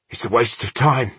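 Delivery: words forced through gritted teeth; through clenched teeth. Acted. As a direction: say it with your jaw locked tight. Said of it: "through clenched teeth".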